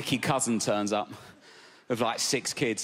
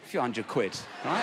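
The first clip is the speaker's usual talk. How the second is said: ordinary delivery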